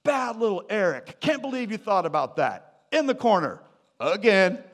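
tut-tut a naughty boy, hammed up; scolding God. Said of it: "Not just like".

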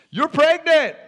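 high, loud mock-thrilled cry; excited announcement. Elizabeth telling Mary the news, excited cry. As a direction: announce it loudly, way up in pitch.

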